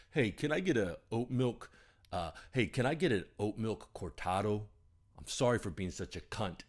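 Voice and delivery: imitating a buddy's East Coast accent, ending in a laugh. Telling listeners how his East Coast buddy ordered coffee, mock East Coast accent. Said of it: "in his East Coast accent".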